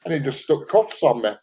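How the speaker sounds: normal talking voice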